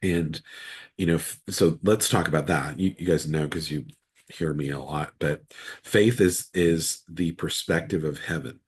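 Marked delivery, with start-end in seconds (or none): none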